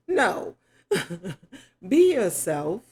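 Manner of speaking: normal talking voice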